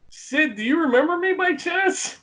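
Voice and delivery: high, tentative mock plea; small, high voice, hoping to be recognised; timid retold question. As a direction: ask meekly from the corner. Timid question to raging wrestler, retold.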